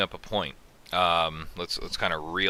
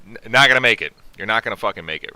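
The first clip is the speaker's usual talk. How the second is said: emphatic; 3 semitones higher, 9 dB louder, faster than usual. Real, not acted. Emphatic warning about illness.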